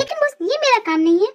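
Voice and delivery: ऊँचे सुर में झुँझलाया विरोध; बॉस के आदेश पर तुनककर आपत्ति करो. Acted. सेक्रेटरी आदेश का विरोध करती है. (तुनकता हुआ विरोध)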